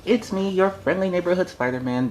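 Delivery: put-on pep it up like a cheerful children's host; kids' show host.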